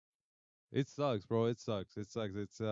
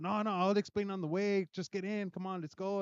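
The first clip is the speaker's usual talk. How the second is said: higher, coaxing stranger voice, lightly voiced; mock pushy stranger; 7 semitones higher than usual. A stranger coaxing a sixth grader into his car, higher pushy voice. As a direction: wheedle him into the car, pitched up.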